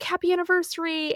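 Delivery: imitated husband, high and bright-spirited; cheery greeting. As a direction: greet with a high celebratory lilt.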